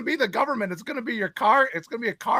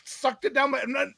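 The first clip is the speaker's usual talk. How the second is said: ordinary talk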